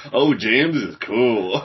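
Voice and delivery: slow grudging. Retold or imitated. Slow grudging agreement retold.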